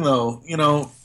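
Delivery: ordinary delivery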